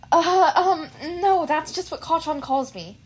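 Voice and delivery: high, loud flustered answer; nervous boy voice. Acted. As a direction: stammer out the correction nervously. Izuku nervous reply.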